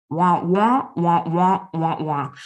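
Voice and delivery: a muffled trombone-like wah wah wah, cartoon teacher style; mock wah-wah droning. Tuning out the pacing CEO, mock wah-wah droning. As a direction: drone the boss's words into meaningless wahs. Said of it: "he starts talking and I hear wah".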